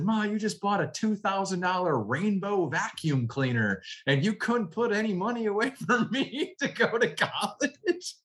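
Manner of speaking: high, laughing indignant retort; laughing complaint. Retold or imitated. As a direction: complain to mom while cracking up. Retold complaint to mom.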